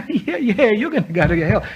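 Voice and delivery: giggly mock answer, voice jumping high; mimicking a girl laughing as she answers. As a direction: laugh it off like it's nothing. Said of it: "And she was laughing".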